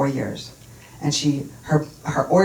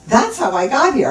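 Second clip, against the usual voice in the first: higher, louder dawning insight; sudden realization; 6 semitones higher, 5 dB louder than usual. Retold or imitated. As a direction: voice a retold eureka moment.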